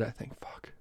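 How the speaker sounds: whispered curse under the breath; muttered curse. Real, not acted. Host whispering a curse to himself mid-sentence. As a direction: let it slip in a soft whisper to yourself.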